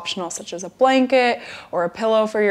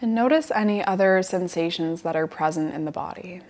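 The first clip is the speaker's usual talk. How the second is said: guided relaxation. Acted. Yoga teacher guiding students lying down to relax, guided relaxation. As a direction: settle them gently into the breath, unhurried.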